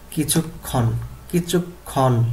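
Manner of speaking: কিছুক্ষণ split as kichuk-khon, double k held; pronunciation model. Shown as a demonstration. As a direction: sound out কিছুক্ষণ with a break before the last syllable. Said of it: "এইভাবে উচ্চারণ করতে হবে".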